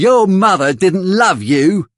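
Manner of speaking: jab at him where it hurts; Noah, taunting. Acted. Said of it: "he says to Oliver".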